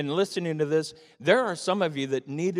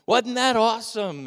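excited; 8 semitones higher, 6 dB louder than usual. Retold or imitated. Excited after zip line.

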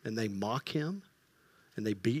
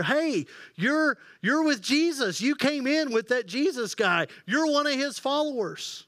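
high, pointed accusation; a little girl calling him out; lightly voiced girl, pitched well up; accusing girl; 9 semitones higher than usual.

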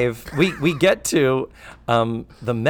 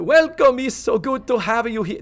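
put-on lightly Italian-accented host greeting; a welcoming Italian maitre d' lilt; still Italian; 10 semitones higher, 3 dB louder than usual. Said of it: "No, still Italian".

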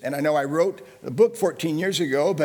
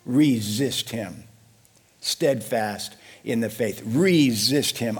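slow, low command; 6 semitones lower, slower than usual. Shown as a demonstration. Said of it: "here's what the Bible says".

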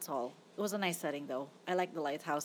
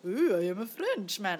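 mock French accent. Movie reviewer mocking the French accent she expected, bad French accent. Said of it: "that's not my French accent".

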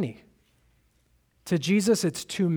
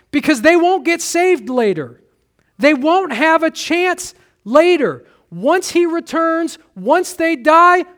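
louder, higher urgent preaching, slowing on key words; preaching at full force; 6 semitones higher, 5 dB louder than usual. Acted. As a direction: plead with the congregation from the pulpit.

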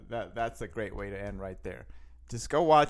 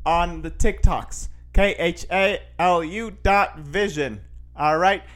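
loud, raised-pitch hype sign-off; podcast outro announcer; 7 semitones higher, 10 dB louder than usual. Real, not acted. Host telling listeners to subscribe and follow, loud announcer voice. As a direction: belt out the plugs like a big show closer.

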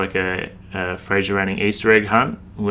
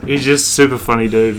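ordinary talk, nothing marked

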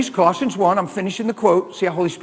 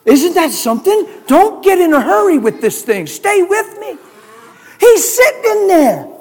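much higher and louder, rising fervor; excited preaching; 12 semitones higher, 9 dB louder than usual. Real, not acted.

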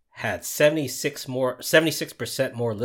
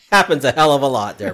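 louder laughing jab; loud teasing retort; 5 semitones higher, 11 dB louder than usual. Real, not acted. Teasing Brian about his swearing, loud retort. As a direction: call him out, loud and teasing.

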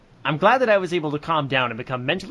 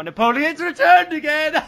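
much higher and louder, laughing maniacally; triumphant villain cackle; 13 semitones higher, 6 dB louder than usual. Acted. Villain persona returning, cackling triumphantly. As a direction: crow with evil glee.

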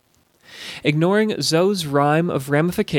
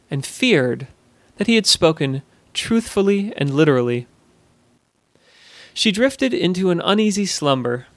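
usual speaking voice

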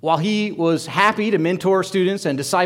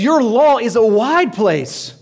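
slow proclaiming psalmist; 5 semitones higher, 5 dB louder, slower than usual. Acted. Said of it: "He says".

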